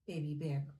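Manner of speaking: ordinary delivery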